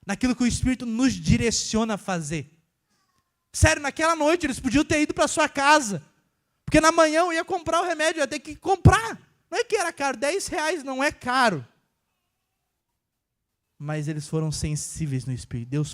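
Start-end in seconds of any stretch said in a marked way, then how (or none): 3.5-11.7 higher and louder preaching push; preaching force. Real, not acted. Preacher insists to the church, louder preaching. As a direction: drive the point home to the congregation.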